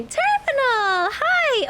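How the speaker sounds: very high, loud, drawn-out hello; excited greeting. Real, not acted. Streamer spotting a viewer in chat, delighted high greeting. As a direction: call out delighted.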